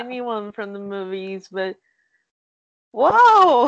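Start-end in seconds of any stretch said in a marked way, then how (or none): 2.9-3.7 loud, high burst of amazement; amazed whoa. Real, not acted. Fan hears friend met the film star, amazed. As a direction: let out a big, high "whoa".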